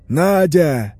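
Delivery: loud, drawn-out desperate call; hoarse screaming. A narrator's reading. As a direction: bellow the name hoarsely through a door. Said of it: "хрипло вопит Ефимов".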